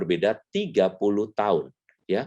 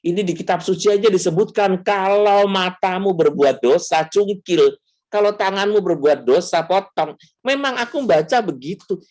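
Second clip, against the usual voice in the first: higher and louder, stubbornly quoting scripture; insistent retort; 10 semitones higher, 4 dB louder than usual. Retold or imitated. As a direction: argue back at mother, stretching dosa, cungkil. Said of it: "saya bilang". (bantahan keras dan tinggi)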